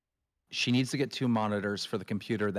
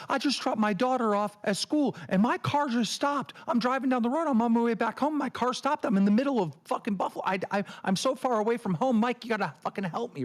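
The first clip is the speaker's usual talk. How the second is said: mock panicked Roxy; 10 semitones higher than usual. Host mimicking Roxy stranded in Buffalo, mock panic. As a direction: imitate a stranded woman freaking out. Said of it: "She goes".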